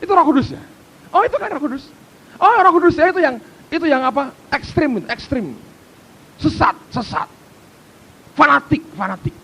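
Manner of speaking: high, loud mock scoffing; dismissive critic. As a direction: parody people labelling it with alarm. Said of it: "Mesti kaitannya begitu".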